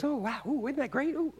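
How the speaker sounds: softly oohing and aahing; mock churchgoer murmur. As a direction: murmur soft admiration like a pretend congregation. Imagined churchgoers quietly admiring the service.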